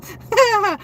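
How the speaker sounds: a sudden high laugh, caught off guard; high burst of laughter. Real, not acted. Laughing at request to do voice.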